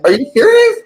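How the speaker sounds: high, horrified squeal of disbelief; recoiling at what you just read; shocked disbelief. Retold or imitated. Reader imagining she's the killer, high horrified disbelief.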